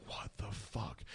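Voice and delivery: breathless whispered disbelief; stunned whisper. Retold or imitated. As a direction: mouth it in shock. Stunned class staring silently.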